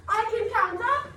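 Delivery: high, loud British chant with a laugh; amused put-on British accent. Shown as a demonstration. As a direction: lead the class in playful British. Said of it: "in the best British accent".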